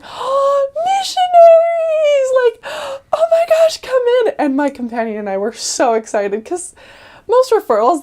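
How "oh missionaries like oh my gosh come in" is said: high, loud, squealing welcome, drawn out on missionaries; thrilled elderly woman's cry of welcome; delighted old lady. Retold or imitated. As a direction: greet them like long-lost grandkids. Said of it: "she's like".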